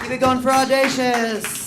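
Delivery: very loud, high, drawn-out announcer call; hype host shout. Real, not acted. Host calling applause for the comic, shouting. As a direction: stretch it out and rouse the crowd.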